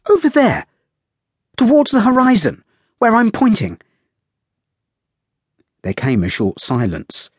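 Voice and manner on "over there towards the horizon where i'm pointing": put-on a spirited girl calling out; excited young lady's voice; high, excited young woman. A narrator's reading. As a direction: pitch it up, eager and pointing.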